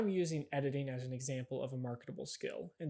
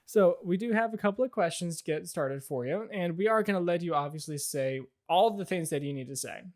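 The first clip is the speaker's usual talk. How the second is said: louder interviewer voice; lightly voiced interviewer; 2 semitones higher, 8 dB louder than usual. Interviewer putting the guest at ease, talk-show voice. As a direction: ask like a talk-show host.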